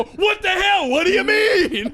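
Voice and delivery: high, loud mock protest; hammed-up cry of what the hell; mock outrage. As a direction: squawk it out in fake indignation. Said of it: "You go".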